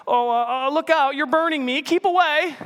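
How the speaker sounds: high, fast, panicky ghost voice; frightened ghost. Acted. Ghost shrinking from the burning angel, high panicky voice.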